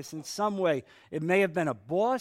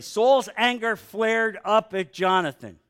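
rising anger; 5 semitones higher, 8 dB louder than usual. A narrator's reading. Reading of Saul's anger.

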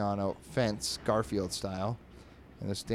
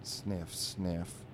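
sad; 4 semitones lower, 4 dB quieter, much slower than usual. Acted. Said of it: "walking all sad".

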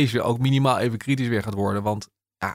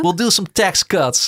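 higher, louder put-on English voice imitating a minister courting Elon; mock minister in English; 7 semitones higher, 5 dB louder, slower than usual. Pretend minister coaxing Elon Musk, playful English put-on voice. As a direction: ham up a politician's sales pitch to Musk. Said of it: "misschien dat hij heeft gezegd van".

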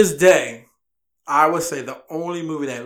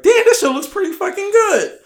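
mock high voice; 14 semitones higher, 4 dB louder than usual. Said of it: "and you're like".

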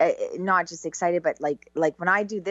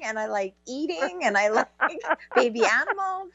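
giggly mock dilemma; 7 semitones higher than usual. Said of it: "I was like".

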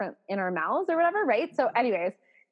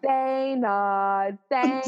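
long, held foghorn name-call; Janice from Friends; much slower than usual. Retold or imitated. Joke sign-up recording for friend, foghorn call. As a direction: honk the name out, stretched. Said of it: "have a recording of my voice".

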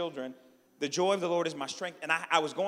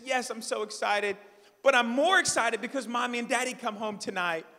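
higher-pitched imitation of an eager child; excited little daughter; 7 semitones higher, 4 dB louder than usual. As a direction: lightly voice an excited little girl. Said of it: "Babe, are you excited?".

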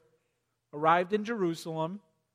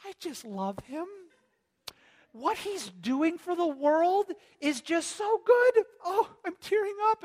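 high, swooning fan voice choking up; gushing churchgoer; 11 semitones higher than usual. Retold or imitated. Preacher mocks deceived church folk gushing over the Antichrist. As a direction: overcome with adoration, nearly tearful. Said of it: "church people who are like".

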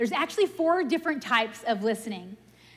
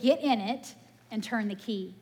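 normal talking voice

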